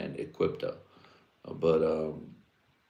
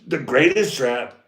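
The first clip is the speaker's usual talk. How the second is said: mock yell; 5 semitones higher, 6 dB louder, slower than usual. Punchline yelled then drawn out.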